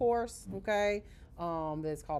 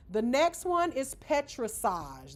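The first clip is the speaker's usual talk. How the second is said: slower naming of petrissage; careful naming; 5 semitones higher, 4 dB louder, slower than usual. Shown as a demonstration. Teacher introducing next technique, slow careful naming. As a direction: announce petrissage deliberately. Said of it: "That's how you pronounce it".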